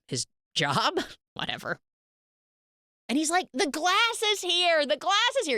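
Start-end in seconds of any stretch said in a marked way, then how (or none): 3.5-5.6 high-pitched excited holler, retold; mock scream. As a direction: imitate calling out from a car, drawn out and high. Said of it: "He's screaming from the car".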